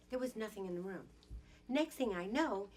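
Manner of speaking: ordinary talk, nothing marked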